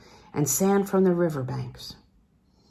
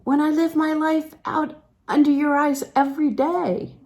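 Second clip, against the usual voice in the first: astonished; 9 semitones higher, 4 dB louder than usual. A narrator's reading. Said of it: "in her astonishment".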